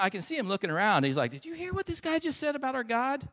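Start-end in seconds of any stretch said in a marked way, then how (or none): 1.3-3.3 very high-pitched, scandalized question; incredulous David. Acted. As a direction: ask round in squeaky disbelief. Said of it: "he looks around".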